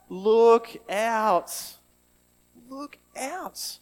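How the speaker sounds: high, loud cry of look out; called warning. Shown as a demonstration. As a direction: call someone back from the path's edge. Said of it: "you call out".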